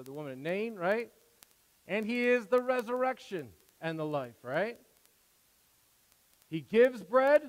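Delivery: loud, high preaching at full force. Acted. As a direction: preach it out to the congregation.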